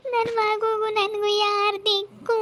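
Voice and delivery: high, slow crying lament; sobbing out her fear of being left alone; wailing lament. Acted. Wife crying that her husband will go to jail.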